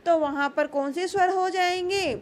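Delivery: usual speaking voice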